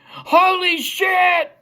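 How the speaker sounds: slow, high, drawn-out exclamation; shocked shout. Retold or imitated. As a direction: let the shock hit out loud.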